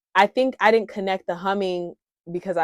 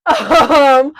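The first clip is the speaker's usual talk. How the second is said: loud laugh running into um; rueful laugh about the crazy driver; 8 semitones higher, 16 dB louder than usual. Real, not acted. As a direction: laugh as you pick the thread back up.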